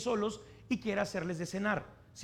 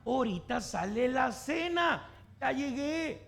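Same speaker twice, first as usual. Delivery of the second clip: high, drawn-out insistence; exasperated mom; 6 semitones higher, slower than usual. Acted. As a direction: promise dinner to nagging kids. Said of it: "¿Qué crees que dice mamá?".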